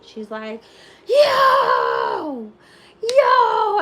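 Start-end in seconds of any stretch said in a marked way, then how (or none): 1.1-3.8 loud, high "Yo!" called out twice; imitated street yelling. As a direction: yell "Yo!" down the street like the girl outside. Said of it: "consistently screaming all day".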